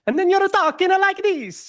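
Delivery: high, big Italian character voice; Italian accent. Shown as a demonstration. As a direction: sing it out like a tipsy Italian. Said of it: "this Italian character I do".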